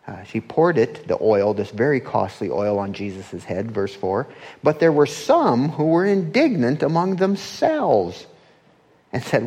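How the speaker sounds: normal talking voice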